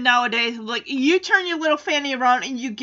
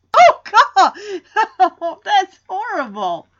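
laughing; 8 semitones higher, 8 dB louder, much slower than usual. Real, not acted. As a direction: burst of horrified laughter, louder and higher. Reacting with horrified laughter.

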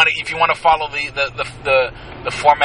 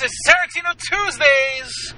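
segment announcer; 12 semitones higher, slower than usual. Acted.

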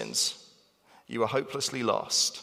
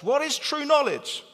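exasperated Paul; 13 semitones higher than usual. Retold or imitated. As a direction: voice Paul scolding, pitch climbing high. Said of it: "he says".

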